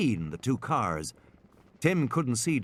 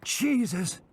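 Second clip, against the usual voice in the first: startled oath; 10 semitones higher than usual. Acted. Ed Regis sees the roaring T-rex.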